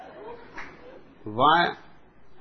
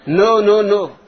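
louder, stammering no's; flustered stutter; 5 semitones higher, 7 dB louder than usual. Acted. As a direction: sputter them out in a fluster. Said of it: "stuttered the old colonel".

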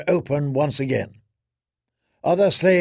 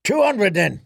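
auctioneer calling; 6 semitones higher than usual. A narrator's reading. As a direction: call out the bid. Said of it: "the auctioneer said".

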